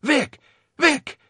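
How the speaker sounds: high, sharp repeated calls; admonishing. A narrator's reading. Binns trying to rouse dazed Victor, admonishing. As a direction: call the name twice, insistent and high. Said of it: "Binns admonished".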